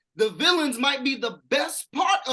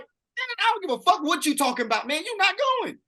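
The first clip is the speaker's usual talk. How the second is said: higher, hammed-up no-nonsense father; mock stern dad; 10 semitones higher than usual. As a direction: play a dad shutting his daughter down flat. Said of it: "a real dad is gonna be like".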